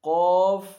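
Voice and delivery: قاف said loud and full from the throat; heavy qaf. Shown as a demonstration. Teacher showing heavy qaf to pupils, loud and full. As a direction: give قاف its heavy sound. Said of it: "جو پر پڑھا جاتا ہے".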